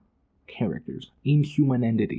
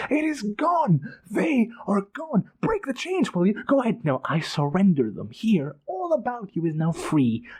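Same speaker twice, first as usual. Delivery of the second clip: high despairing shriek; 8 semitones higher than usual. A narrator's reading. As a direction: cry out over the lost empire. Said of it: "Calor shrieked".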